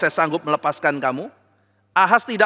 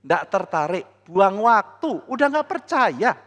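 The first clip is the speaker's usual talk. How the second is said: ordinary talk, nothing marked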